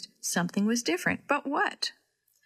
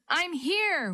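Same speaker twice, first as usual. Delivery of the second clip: calling back; 8 semitones higher than usual. A narrator's reading. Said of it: "Willie called back".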